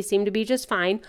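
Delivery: ordinary talk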